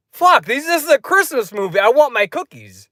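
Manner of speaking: high-pitched, indignant cookie demand; retold anger. Moviegoer fumes over cookies dumped on the ground, indignant. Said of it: "I held back my anger".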